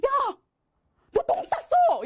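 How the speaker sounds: mock panicked shout; retold shout. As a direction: blurt it out in alarm. Friend shouting in study room.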